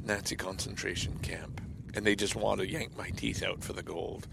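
hushed, breathy whisper of a grim thought; whispered dread. Real, not acted. Vlogger whispering his darkest fear to viewers. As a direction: drop to a whisper as the horror sinks in. Dark fantasy dropped to a whisper.

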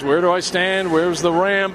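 loud retold shout at transit guys; yelling at the transit guys for directions. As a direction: call out the questions loudly, as if across a platform. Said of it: "And I yelled at some transit guys".

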